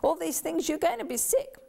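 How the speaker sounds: mother's high, worried warning; mother fretting that her girls will get sick. Retold or imitated. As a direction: warn the girls they'll fall ill. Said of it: "And Mum said".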